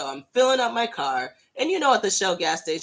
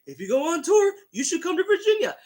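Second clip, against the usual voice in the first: high, quick, pleading fan voice; eager fan plea; 11 semitones higher than usual. Retold or imitated. Fan begging the band to tour Virginia, high and eager. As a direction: beg them sweetly to come.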